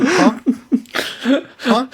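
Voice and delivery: imitating a stunned reaction; mock bafflement. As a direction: squeak it out as if dumbfounded. Magnus baffled by the demand.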